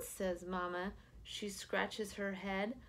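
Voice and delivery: ordinary delivery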